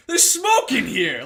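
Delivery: put-on teen spotting smoke in the bedroom; alarmed discovery. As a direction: sound the alarm as it dawns.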